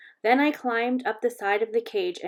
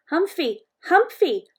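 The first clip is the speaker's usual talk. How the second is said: put-on high, loud little-kid voice; little toddler calling out; 8 semitones higher, 7 dB louder, slower than usual. A narrator's reading. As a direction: call out in a tiny child's voice. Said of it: "a little voice called out".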